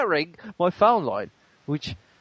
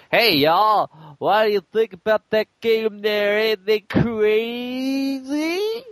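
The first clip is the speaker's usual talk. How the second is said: silly buffoon voice; 7 semitones higher, much slower than usual. Retold or imitated. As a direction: ham up a dim-witted yokel. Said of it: "in a silly voice that makes Greg sound like a buffoon".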